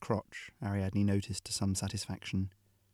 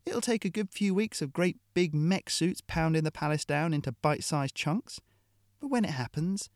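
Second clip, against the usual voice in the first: hard-nosed mercenary woman; 7 semitones higher than usual. Acted. Hill lecturing the captive Ariadne, scornful and blunt. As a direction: lecture the prisoner with contempt.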